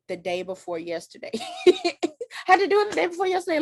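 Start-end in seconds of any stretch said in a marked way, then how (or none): 1.7-3.6 fast, high, laughing through the words. Real, not acted. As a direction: laugh it out quickly at yourself. Laughing at her own confession.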